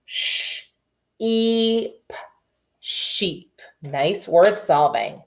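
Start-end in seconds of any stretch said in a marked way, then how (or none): 0.1-3.6 "Sh, e, p" in separate sounds, then "sheep"; sounding out. Shown as a demonstration. Teacher blending the word for the class. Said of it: "say the parts of the words and put them together".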